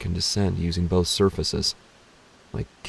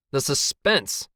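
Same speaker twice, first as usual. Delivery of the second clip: higher, more forceful "The suspense!"; exclaimed suspense; 6 semitones higher, slower than usual. A narrator's reading. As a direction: cry out "suspense" in exasperation. Dramatic repeat, killed by suspense.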